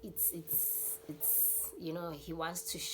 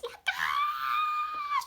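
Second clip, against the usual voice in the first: very high shrieking ah with a laugh; much higher loud mock scream; 6 dB louder than usual. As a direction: shriek like a terrified friend. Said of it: "She was screaming so hard".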